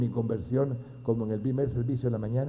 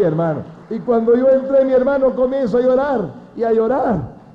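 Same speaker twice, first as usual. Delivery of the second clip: fervent preaching; 7 semitones higher, 9 dB louder than usual. Acted. Preacher testifying to his brothers, loud fervent cry. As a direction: proclaim it to the congregation.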